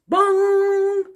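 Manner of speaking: voiced bong like a big clock bell. A narrator's reading. Narrator voicing Big Ben striking, bell bong.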